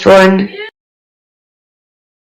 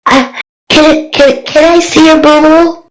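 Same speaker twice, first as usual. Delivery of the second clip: put-on raised, timid child voice; a small girl shyly asking to see the hurt; little girl voice; 8 semitones higher than usual. Little girl asking Maggie about her wound, small childlike voice.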